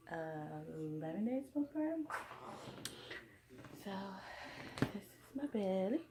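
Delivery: muted, hushed vlog voice. Real, not acted. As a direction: keep it hushed so he sleeps. Whispering near sleeping baby.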